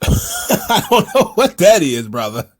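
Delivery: laughing through the words; amused laugh at a silly move name. Real, not acted. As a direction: chuckle it out, amused.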